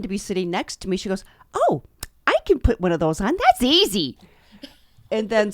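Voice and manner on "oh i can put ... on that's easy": quick, rising to high and loud; imitated eager offer. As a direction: act out a confident friend jumping in. Acts friend eagerly volunteering.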